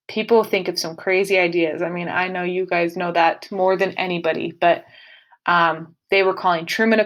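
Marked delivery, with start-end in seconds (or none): none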